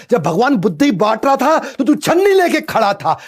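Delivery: put-on high, loud taunting jab at the child; a mother taunting her child, high and loud; mocking insult. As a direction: sneer it out like a scolding mother. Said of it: "क्या क्या बोल देते हैं".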